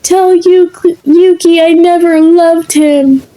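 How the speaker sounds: high, loud cry through the portal; desperate ghostly yell, high and loud; yelled from the afterlife. Acted. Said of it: "and someone yelled".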